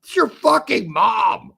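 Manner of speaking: loud, high jeering shout; shouted insult. Acted. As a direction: yell it as a crude comeback.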